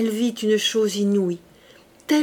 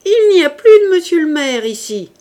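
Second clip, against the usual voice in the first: scornful outburst; 9 semitones higher, 12 dB louder than usual. A narrator's reading. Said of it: "éclata de rire, de cet affreux rire".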